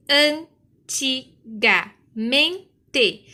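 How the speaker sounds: "antigamente" very slowly, an - ti - ga - men - te; five loud, separate beats for "antigamente"; split into syllables. Shown as a demonstration. Teacher demonstrates five-syllable split.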